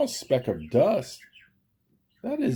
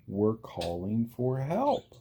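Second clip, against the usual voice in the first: plain reading voice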